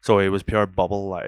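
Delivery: normal talking voice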